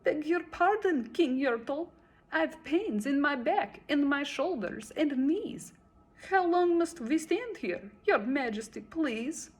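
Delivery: high, pleading little voice; meek little turtle. A narrator's reading. Small turtle pleading with the king. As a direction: beg timidly from the bottom. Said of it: "this plain little turtle looked up and he said".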